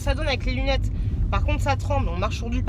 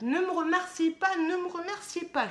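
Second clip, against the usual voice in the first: faux modeste; 6 semitones higher, 3 dB quieter than usual. Mock-modest refusal of thanks.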